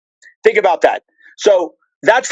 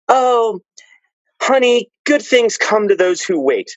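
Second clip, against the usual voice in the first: higher, slow cooing consolation; cooing platitude; 4 semitones higher, slower than usual. Acted. Parroting everyone's misread quote to a honey, higher cooing consolation. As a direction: pat someone on the head, slowly, in a higher pitch. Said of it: "you know how everybody says".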